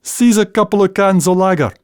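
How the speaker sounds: broad Scots, asking for lager; Scots request. Shown as a demonstration. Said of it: "as in".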